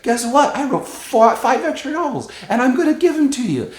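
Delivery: hammed-up delighted imitation of a big reveal; gleeful surprise. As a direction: squeal the big surprise like a kid with a gift.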